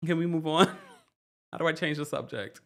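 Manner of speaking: ordinary talk, nothing marked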